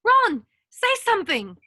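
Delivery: high, loud worried call; panicked call to Ron; alarmed cry. Acted. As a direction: cry out to a fallen friend. Ron drops after his spell backfires.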